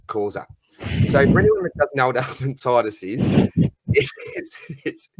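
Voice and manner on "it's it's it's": breaking into giggles mid-word; stifled laugh. Real, not acted. Explaining a joke to mates, breaking into stifled laughter. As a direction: try not to laugh and fail. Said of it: "I shouldn't laugh".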